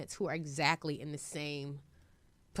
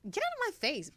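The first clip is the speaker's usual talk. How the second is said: mock brush-off. Her retort to begging parents.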